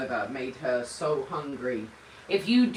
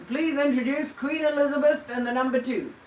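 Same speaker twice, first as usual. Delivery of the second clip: mock announcer voice; 7 semitones higher than usual. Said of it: "she wasn't".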